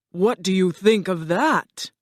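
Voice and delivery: grand, forceful put-on wizard voice; commanding wizard. Wizard sets the lion his task, grand commanding voice. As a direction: lay down terms as the mighty Oz.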